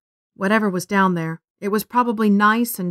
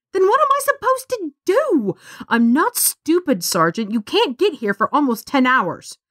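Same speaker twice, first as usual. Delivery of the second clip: upset; 6 semitones higher than usual. A narrator's reading. Stranded girl argues with sergeant.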